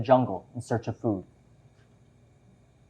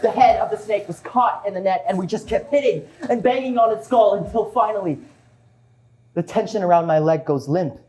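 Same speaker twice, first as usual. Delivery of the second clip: excited, building panic; 6 semitones higher, 3 dB louder than usual. Retold or imitated. Fighting off a python, retold.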